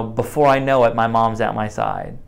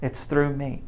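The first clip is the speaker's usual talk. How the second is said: gentle reassurance; 2 semitones higher, 9 dB quieter than usual. Acted. Jesus reassuring, softly.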